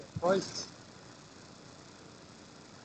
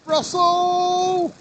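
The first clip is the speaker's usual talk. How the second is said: much higher and louder, stretched; hype announcer; 11 semitones higher, 7 dB louder than usual. Acted. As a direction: shout the winner's name out big. Announcing a placing.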